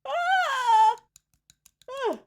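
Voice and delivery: big open-mouthed yawn, loud and high. Real, not acted. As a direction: stretch out a loud real yawn. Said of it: "sorry for the yawns".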